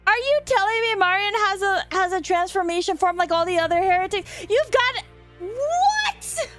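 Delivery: high, loud disbelieving outburst; shocked exclamation. Real, not acted. Streamer reacting to plot twist.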